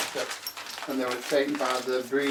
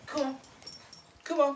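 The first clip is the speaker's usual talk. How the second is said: calling a dog; 14 semitones higher, 3 dB quieter than usual. Real, not acted.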